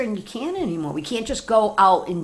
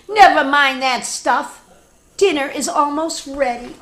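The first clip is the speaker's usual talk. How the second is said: put-on bossy girl pig; 7 semitones higher, 7 dB louder than usual. Girl pig voiced in story.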